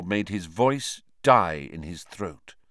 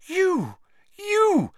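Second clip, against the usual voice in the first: wild accusing shout; 5 dB louder than usual. Acted. Hermione accusing Lupin wildly.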